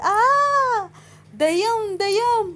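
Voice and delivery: high, slow, loud panicked cry; terrified screaming. A narrator's reading. Ananya clinging to Abhiram, terrified high scream. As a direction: shriek as if a ghost grabbed you. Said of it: "అని అరుస్తూ".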